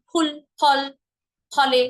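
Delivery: ফুল, ফল, ফলে starting on aspirated p; both lips touching. Shown as a demonstration. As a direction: pop each ফ in ফুল, ফল, ফলে off closed lips. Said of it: "দুইটা ঠোঁট একসাথে স্পর্শ করে". (দুই ঠোঁট ছুঁয়ে ফুল, ফল, ফলে)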